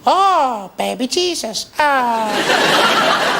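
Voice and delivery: very high, slow squeaky alien; a fat alien struggling with English. Retold or imitated. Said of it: "fat aliens learning to speak English, going".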